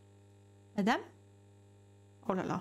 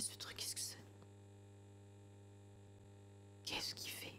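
tense hushed whisper; nervous whisper in the dark; 14 dB quieter, slower than usual. Real, not acted. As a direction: breathe the words, afraid to be heard. Creepy figure, hushed questions.

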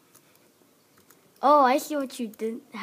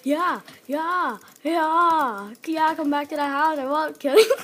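loud mock reassurance; 4 semitones higher, 7 dB louder than usual. Mock reassurance while trolling.

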